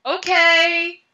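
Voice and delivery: high, loud, drawn-out okay; exasperated giving in. Retold or imitated. Driver finally obeys the voice telling her to go home, exasperated. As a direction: stretch it out high as you give in.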